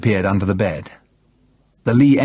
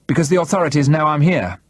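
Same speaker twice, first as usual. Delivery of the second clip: raised pitch, measured defiance; defiant; 7 semitones higher, faster than usual. A narrator's reading. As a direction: push back with a defiant claim. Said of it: "I said defiantly".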